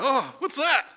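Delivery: high, loud surprised exclamation; startled curiosity. Acted. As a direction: blurt it out as if something caught you off guard. Acting out noticing an emotion.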